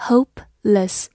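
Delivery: syllable by syllable. Shown as a demonstration. Teacher builds "Hopeless" for learners, syllables slowly separated. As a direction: say "Hopeless" in two separated parts. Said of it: "H O P E".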